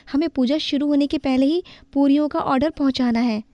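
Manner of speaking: high, brisk instructing; busy young woman. Acted. Daughter-in-law tells Swara to fry puris, busy. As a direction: hurry her along with the order. Widow bahu giving orders.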